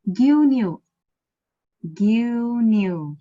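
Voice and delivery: gyunyu with both u sounds held long; prolonged vowels. Shown as a demonstration. Teacher showing students long vowels, gyunyu drawn out. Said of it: "You are prolonging that".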